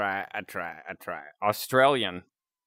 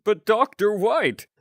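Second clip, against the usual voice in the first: Invader Zim; 11 semitones higher, 3 dB louder than usual. Acted. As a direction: pitch it way up in a hammed-up cartoon voice. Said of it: "I wanna quote Invader Zim".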